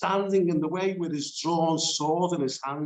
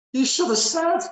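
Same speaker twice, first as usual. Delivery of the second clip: 1 mock incredulous; 12 semitones higher, 4 dB louder than usual. Mocking God's reply.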